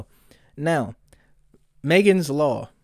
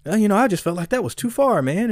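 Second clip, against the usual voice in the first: mocking impression of [name], much higher; mimicking [name]; 9 semitones higher, 3 dB louder than usual. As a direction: imitate him sounding hurt, pitched way up. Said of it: "was like".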